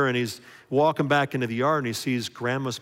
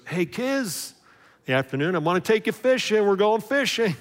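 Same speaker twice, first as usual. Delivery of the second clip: high, loud, hearty grandpa call; excited grandpa; 8 semitones higher, 5 dB louder than usual. Retold or imitated. Grandpa inviting the kids fishing, high and loud. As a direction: announce the fishing trip with big excitement.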